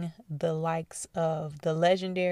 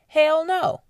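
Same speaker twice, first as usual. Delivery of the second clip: emphatic hell no; 10 semitones higher, 9 dB louder than usual. Real, not acted. Emphatic answer to own question.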